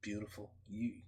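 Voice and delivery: half-joking tender flattery, very soft; mock tender compliment. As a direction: murmur it like a sweet nothing to the wife. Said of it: "I'm gonna be like".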